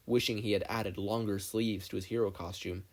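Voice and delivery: ordinary delivery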